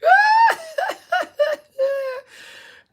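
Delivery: a big real cackle; howling laugh; loud high laugh. Real, not acted. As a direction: burst out in shrieking laughter. Cracks up at own story.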